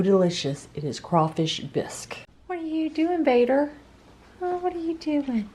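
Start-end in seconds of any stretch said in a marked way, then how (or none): 2.5-3.7 much higher, cooing pet voice. Real, not acted. Owner cooing at her cat Vader in a high pet voice. 4.4-5.5 much higher, cooing pet voice. Real, not acted. Owner cooing at her cat Vader in a high pet voice.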